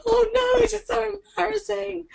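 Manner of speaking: high, squirming mock embarrassment; mock embarrassed trainee. Voicing the embarrassed webinar viewer, high mock squirm. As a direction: whine like a shy trainee. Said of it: "now you're gonna think".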